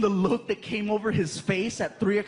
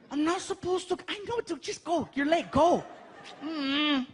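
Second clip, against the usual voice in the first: put-on kid's voice; 6 semitones higher than usual. Frankie and dad at school.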